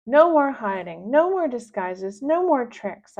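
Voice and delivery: loud, determined shouted list; yelling. Acted. Girl to Mary, loud determined yell. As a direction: declare war out loud. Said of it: "I yell".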